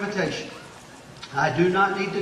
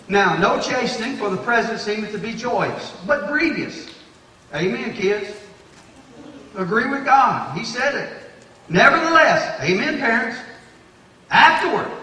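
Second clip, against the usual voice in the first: higher and louder, preaching hard; full-force preaching; 5 semitones higher, 6 dB louder than usual. Real, not acted. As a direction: preach it out to the congregation. Preaching scripture with rising fervour.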